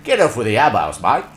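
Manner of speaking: loud, coarse Australian caricature; broad Australian, punched out loud; Australian accent. Retold or imitated. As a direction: imitate a brash Australian telling someone off. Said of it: "the accent is still very".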